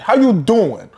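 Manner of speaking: high-pitched mock New York Italian. Co-host correcting the accent guess, in New York Italian. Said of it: "it was like New York Italian".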